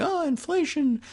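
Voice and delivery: high-pitched mock lament; mock complaining. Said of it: "we keep complaining".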